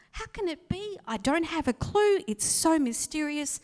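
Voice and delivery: high, loud, amazed voice; wondering Mary. Retold or imitated. Preacher voicing Mary's wonder, high and amazed. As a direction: marvel at the mystery.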